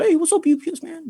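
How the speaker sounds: very high, cheery mimicked hail; exaggerated friendly local voice; friendly neighbourhood greeting. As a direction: call out to the delivery man. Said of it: "most people have been supportive".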